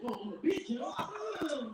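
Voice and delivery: a hint of sassy challenge; mock sass. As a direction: throw it back at her with a laugh.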